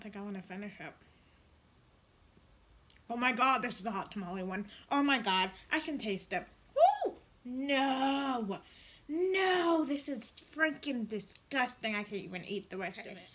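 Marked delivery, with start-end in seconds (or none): 3.1-11.9 loud, high shocked cry; shocked outburst. Real, not acted. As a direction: yell in disgusted shock. Tasting the hot tamale candy.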